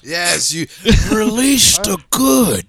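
high, loud, slow put-on voice with a laugh; laughing mock movie voice; film quote impression. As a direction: ham up the movie line. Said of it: "I love that part".

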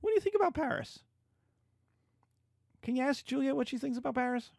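husband asking wife about the match; mock Capulet asking wife. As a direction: quiz the wife lightly. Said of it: "Capulet then goes to his wife and says".

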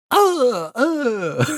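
squeaky high-pitched grunts; mock a fighter's grunts; high fight grunts. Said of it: "I remember Tommy's cadence".

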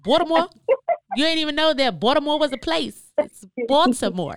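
Baltimore said as "Balmore", the T dropped; host teasing guest's Baltimore accent. Shown as a demonstration. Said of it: "They don't pronounce they T's".

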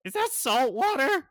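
high, loud alarmed question; Gizmo alarmed. Acted. Gizmo looks out the window at the sea, high alarmed voice. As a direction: ask it in a high nervous squawk.

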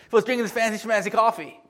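ordinary delivery

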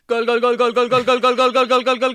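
high, loud goal call, stretched Ronaldo; frantic mock sports commentary. Mock football goal celebration.

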